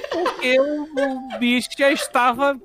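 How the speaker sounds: laughing. Real, not acted. Laughing at the boss melting.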